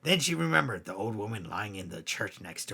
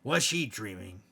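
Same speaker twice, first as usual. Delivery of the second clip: ordinary delivery, nothing marked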